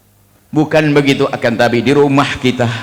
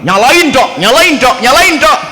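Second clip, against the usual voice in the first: high, loud mock demand; mocking arrogant voice; 12 semitones higher, 5 dB louder than usual. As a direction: mimic a know-it-all ordering the doctor, teasing. Mimicking an arrogant man. (meniru orang sombong, nada tinggi dan keras)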